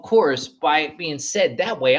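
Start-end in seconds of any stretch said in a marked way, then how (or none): none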